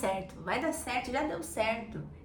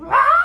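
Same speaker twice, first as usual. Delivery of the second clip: grito altíssimo e agudo no carro; grito forte; 17 dB louder than usual. Shown as a demonstration. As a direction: grite a raiva pra fora. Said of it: "você vai dar uns gritos". (grito de desabafo no carro)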